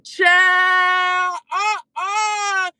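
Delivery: high, loud shocked cry of disbelief; shocked outcry. Real, not acted. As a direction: shriek it out in disbelief. Shocked reaction to seven-dollar tickets.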